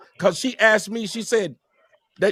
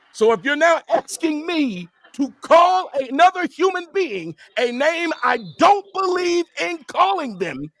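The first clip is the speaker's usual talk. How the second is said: heated shout; 7 semitones higher than usual. Real, not acted. Heated argument about the slur.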